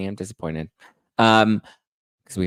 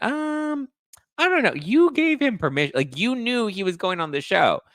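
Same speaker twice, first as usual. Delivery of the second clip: exasperated squeal; 12 semitones higher than usual. Real, not acted. Host loses patience with the girlfriend, much higher. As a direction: squeak out the disbelief.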